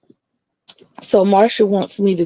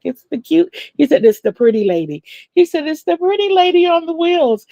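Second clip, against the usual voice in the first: put-on little boy's voice; 9 semitones higher than usual. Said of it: "He was like".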